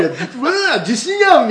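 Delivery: high, loud, laughing alarm; startled cry. Retold or imitated. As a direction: yelp it out half laughing. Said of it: "みたいな".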